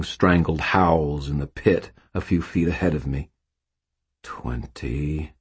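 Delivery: usual speaking voice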